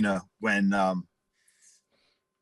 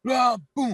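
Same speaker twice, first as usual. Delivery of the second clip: high-pitched battle yell into a boom; mock roar of collision; imitated charge and crash; 12 semitones higher than usual. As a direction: yell the impact like a sound effect.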